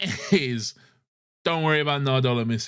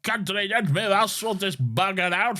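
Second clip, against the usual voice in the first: put-on old British man; 10 semitones higher than usual. Old Redcoat threatening the Doctor, high hammy British voice. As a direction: ham up a pompous old British gent. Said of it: "this old British man".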